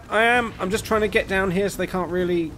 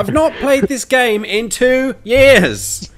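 exasperated; 9 semitones higher, 8 dB louder, much slower than usual. Real, not acted. Defending himself, exasperated.

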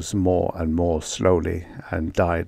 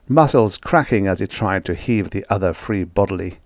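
ordinary delivery

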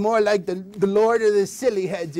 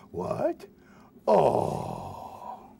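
sad dog; 7 semitones lower, 7 dB quieter than usual. Acted. Dog told no bone.

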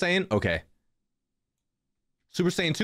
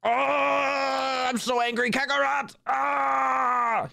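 high, straining mock rage shout; over-the-top anime fury; hammed-up angry Vegeta; 11 semitones higher, much slower than usual. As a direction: scream at Kakarot like a furious prince. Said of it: "And he's angry".

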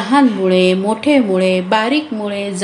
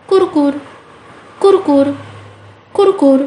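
higher crunchy kurkur nibbling noise; gnawing sound; 5 semitones higher than usual. Acted. Mouse gnaws the radish roots underground, playful nibbling noise.

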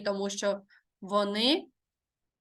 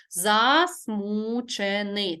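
"Засмучений" slowly, broken into syllables; slow, separated syllables in "Засмучений"; much slower than usual. Shown as a demonstration. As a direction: dictate "Засмучений" one drawn-out syllable at a time. Teacher dictates the antonym by syllables.

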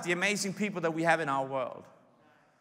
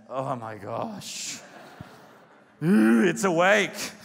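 low, slow groan, then louder mock dread; weary spouse; 3 semitones lower, 4 dB louder, much slower than usual. Ten years married, groaning at the spouse. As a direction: dread your spouse waking up. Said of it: "Ten years into marriage".

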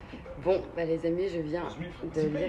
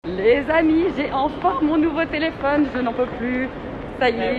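excited; 7 semitones higher than usual. Real, not acted. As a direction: burst out with the good news. Thrilled about her new phone. (voix surexcitée, aiguë et forte)

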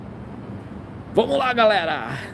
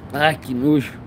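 coughing, disgusted; clearing the throat in disgust; just swallowed a bug; 5 semitones lower than usual. Real, not acted.

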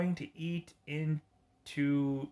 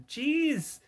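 high exclamation; 13 semitones higher than usual. Acted. Villain exclaiming in game.

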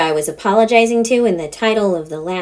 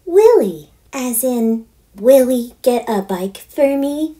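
put-on higher, excited girl voice, slow on perfect fern; eager girl; 4 semitones higher, slower than usual. Daughter announcing her audition idea, eager.

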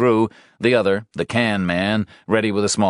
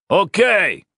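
loud, higher call; shouting; 13 semitones higher, 5 dB louder than usual. A narrator's reading. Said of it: "shouted Johnson".

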